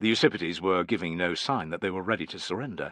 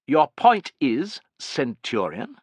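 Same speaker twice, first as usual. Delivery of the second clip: "Centurion?" set apart and weighted, rising at the end; pointed, slow question ending on a heavy "Centurion?"; heavy stress on "Centurion"; 6 semitones higher, 3 dB louder, slower than usual. A narrator's reading. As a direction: drag out the question, then lean on "Centurion?". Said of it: "laid heavy emphasis on the last word".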